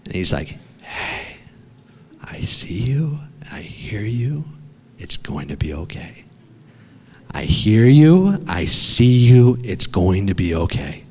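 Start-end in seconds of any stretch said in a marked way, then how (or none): 0.8-6.2 soft, breath-only whisper; father's hushed reassurance. Retold or imitated. Father finding his trapped son in the cave, whispered reassurance. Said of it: "it's the voice of my father". 7.3-11.0 father's firm reassurance. Retold or imitated. Father calming his trapped son in the cave, steady reassurance. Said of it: "it's the voice of my father".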